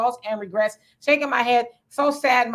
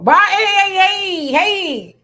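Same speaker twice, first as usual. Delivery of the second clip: much louder and higher hey, hey, hey to cut in; loud calling out; 8 semitones higher, 9 dB louder, much slower than usual. Real, not acted. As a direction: call over the other speaker to move on. Cuts in to move on.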